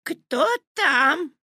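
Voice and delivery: very high, put-on granny voice; old grandmother. A narrator's reading. Grandmother calling to whoever knocked, high granny voice. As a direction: call out high and loud. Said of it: "спросила бабушка".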